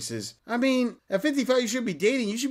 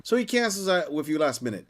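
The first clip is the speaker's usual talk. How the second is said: usual speaking voice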